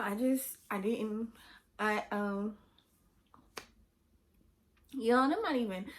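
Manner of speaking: ordinary talk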